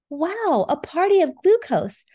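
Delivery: high, bright excited gasp; thrilled at the sight. Acted. Peppa excited by glucose.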